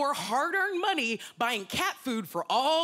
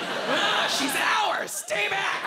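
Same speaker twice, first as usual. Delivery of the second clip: higher, louder "she's ours! Stay back!"; guard her like King Kong swatting; protective shout; 8 semitones higher, 4 dB louder, much slower than usual. Retold or imitated. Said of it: "They're like".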